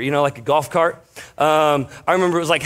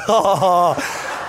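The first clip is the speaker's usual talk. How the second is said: burst of laughter. Real, not acted. Laughing at own story.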